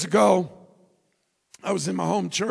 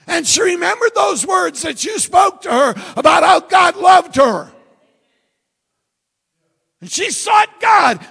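raised, high-pitched preacher's voice; full-force preaching; 7 semitones higher, 7 dB louder than usual. Real, not acted. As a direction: drive it home to the congregation.

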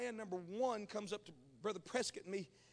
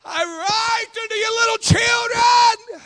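loud, high and slow; shouted, drawn-out scripture reading; proclaimed scripture; 22 dB louder, slower than usual. Acted. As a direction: ring out the verse like a pulpit proclamation. Scripture proclaimed at full force.

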